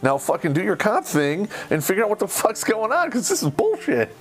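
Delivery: indignant veteran ranting at useless police; angry rant at cops. Retold or imitated. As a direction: tear into the cops, pitch climbing. Yelling at the police.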